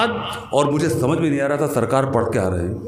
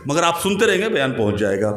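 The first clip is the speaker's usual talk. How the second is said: ordinary delivery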